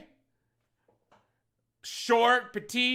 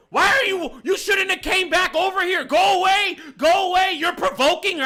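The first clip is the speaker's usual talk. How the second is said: much higher and louder mock shouting; hammed-up scolding yell; the girls telling the owner to go away, shouted; imitated yelling; 12 semitones higher, 6 dB louder than usual. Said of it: "they were like".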